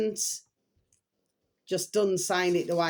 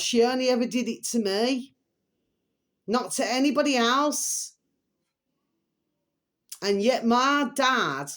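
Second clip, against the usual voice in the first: normal talking voice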